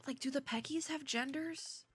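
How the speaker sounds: hushed, voiceless aside; whispering. Real, not acted. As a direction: whisper it like a secret question. Hushed aside about the animals.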